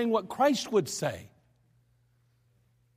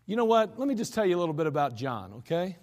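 usual speaking voice

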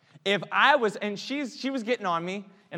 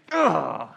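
frustrated ugh; 9 dB louder than usual. Real, not acted. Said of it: "getting a little frustrated".

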